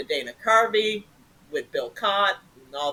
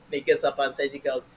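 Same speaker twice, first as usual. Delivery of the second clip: usual speaking voice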